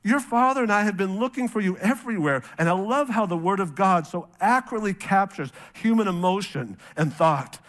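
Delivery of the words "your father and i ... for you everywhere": upset mother. Retold or imitated. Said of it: "she says, this is so striking".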